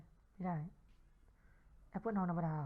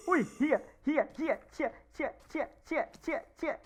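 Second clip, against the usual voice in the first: high, startled burst of curses; panicked swearing; 6 semitones higher, 5 dB louder than usual. Real, not acted. Gamer startled by a scary noise, panicked high swearing.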